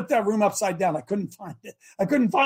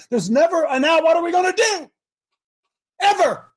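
higher, louder, hammering it home; emphatic shout; 8 semitones higher, 4 dB louder than usual. Real, not acted. Preacher shouting his point at the audience, loud and emphatic. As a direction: hit ever hard both times. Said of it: "emphatic shout".

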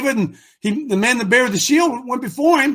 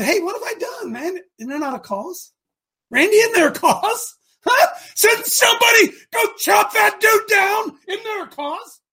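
high, incredulous cries of protest; indignant David; 10 semitones higher than usual. Acted. Preacher as David challenging the soldiers, high and outraged. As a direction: demand a reason, voice climbing.

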